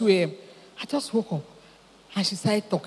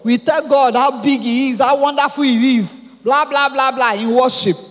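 mock loud worship; 4 semitones higher, 7 dB louder than usual.

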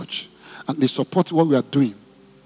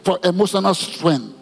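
leading prayer; 3 semitones higher than usual. Acted. Pastor leads congregation praying for mothers, fervent prayer. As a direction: lead the church in prayer for mothers and children.

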